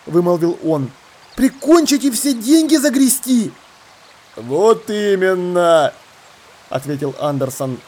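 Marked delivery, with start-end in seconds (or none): 1.4-3.5 high-pitched incredulous question; horrified bewilderment. Acted. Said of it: "отразились ужас и недоумение". 4.4-5.9 pirate leader. Acted.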